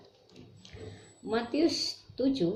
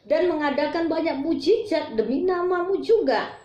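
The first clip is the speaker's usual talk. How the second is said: high, pleading Tuhan, Tuhan, bukakan; desperate begging; 7 semitones higher, 4 dB louder than usual. Acted. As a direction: beg at the closed door of heaven. Quoting pleading followers again. (memohon putus asa dengan suara tinggi)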